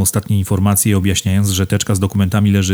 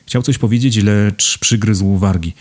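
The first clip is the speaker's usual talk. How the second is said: plain reading voice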